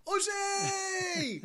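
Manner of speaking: high loud drawn call; joyful shout. Retold or imitated. [name] greeting [name], shouted high. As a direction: call out delighted. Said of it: "he goes".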